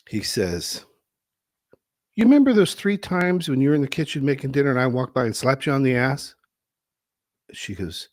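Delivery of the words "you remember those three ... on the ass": smug husband. Retold or imitated. Husband proudly explaining in story.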